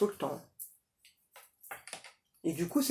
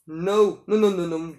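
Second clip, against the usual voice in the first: insistent no; 2 semitones lower, 7 dB louder than usual. Real, not acted. Emphatic refusal.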